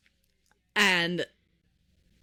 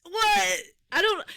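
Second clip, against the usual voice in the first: high-pitched startled yelp, played back; a retold squeak of shock; mock startled; 10 semitones higher, 3 dB louder, slower than usual. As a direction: re-enact being taken aback. Acting out her startled reply.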